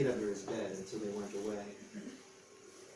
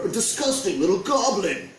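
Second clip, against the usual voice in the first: higher, louder sneering innkeeper; loud scornful innkeeper; disgusted; 8 semitones higher, 8 dB louder than usual. A narrator's reading. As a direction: spit it out in disgust. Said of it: "said the innkeeper".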